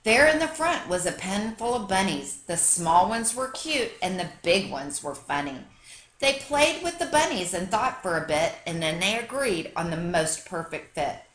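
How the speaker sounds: ordinary delivery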